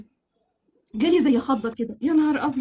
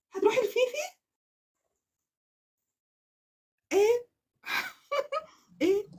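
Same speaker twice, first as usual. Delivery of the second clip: high, incredulous question; high disbelief; 10 semitones higher, 5 dB quieter than usual. Real, not acted. Vlogger asking her bag if it goes to Fifi, high. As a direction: ask it squeaking in disbelief.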